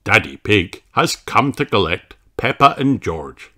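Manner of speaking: ordinary delivery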